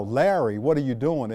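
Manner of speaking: ordinary talk, nothing marked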